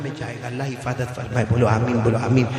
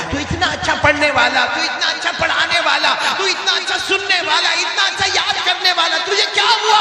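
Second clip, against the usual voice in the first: high, loud sermon build-up with laughter; impassioned preacher pitch; fiery preaching; 12 semitones higher, 4 dB louder than usual. Acted. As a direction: rouse the crowd, rising to a cry. Teacher cries to student in dream.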